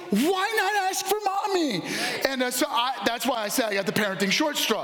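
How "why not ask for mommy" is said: high, whiny mock complaint; mock whine. Tired dad to kids, high mock whine. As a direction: whine it like a put-upon dad. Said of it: "I'm like".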